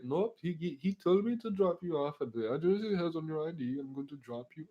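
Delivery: high put-on driver voice; imitated cabbie laying down the rules. Voices the cab driver refusing.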